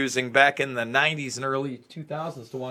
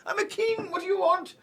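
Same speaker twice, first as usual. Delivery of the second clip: much higher, louder mock Irish accent; squeaky hammed-up Irish brogue; hackneyed Irish accent; 10 dB louder than usual. Host mocking a fighter with a squeaky Irish accent. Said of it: "my hackneyed Irish accent".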